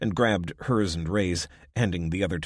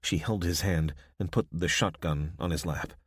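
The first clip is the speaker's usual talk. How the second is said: ordinary delivery, nothing marked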